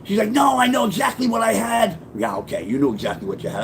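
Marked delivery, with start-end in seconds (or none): 0.3-2.0 mother's insistence. Retold or imitated. 2.1-3.6 sarcastic. Retold or imitated. Sarcastic reply to mother.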